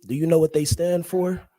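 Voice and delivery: usual speaking voice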